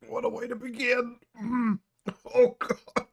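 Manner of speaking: coughing, high and strained after choking; sputtering after water went down wrong. Real, not acted. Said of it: "swallowed that water really wrong".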